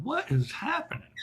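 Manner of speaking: high-pitched mock disbelief; bewildered reaction. Bear reacting to the hit man's message, in high bewilderment. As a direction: swoop up high, imitating a baffled man.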